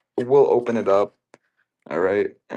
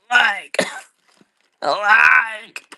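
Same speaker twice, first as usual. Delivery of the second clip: higher, louder Borat "I like"; Borat catchphrase; 12 semitones higher, 7 dB louder, much slower than usual. Acted. To viewers, the Borat catchphrase, higher and louder. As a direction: belt the catchphrase in Borat accent.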